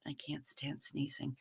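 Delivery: a hushed echo to herself; whispered repeat. Real, not acted. Streamer repeats her complaint about sneezing to herself, whispered. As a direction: whisper the line again under her breath. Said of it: "yeah, I can't stand sneezing".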